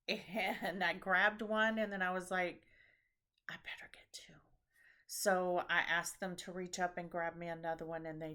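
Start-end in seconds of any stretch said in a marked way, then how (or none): 3.5-4.3 unvoiced little whisper. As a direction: whisper it to yourself. Whispered thought.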